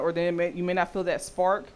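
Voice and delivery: ordinary talk, nothing marked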